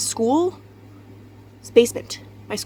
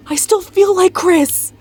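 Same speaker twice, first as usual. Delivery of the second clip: loud frustrated outburst; 8 semitones higher, 6 dB louder than usual. Real, not acted. Venting about relationship.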